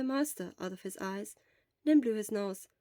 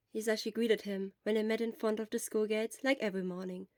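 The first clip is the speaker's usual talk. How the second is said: plain reading voice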